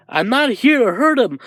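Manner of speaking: high, insistent protest; earnest protest. Acted. All Might reassures raging Dark Shadow, insistent protest. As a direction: protest high, then ease off.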